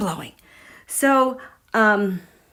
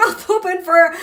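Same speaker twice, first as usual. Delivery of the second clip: normal talking voice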